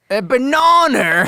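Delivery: put-on heavy Australian accent, slow and high. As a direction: drawl out in a broad Australian accent. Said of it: "speaks in a really heavy Australian accent".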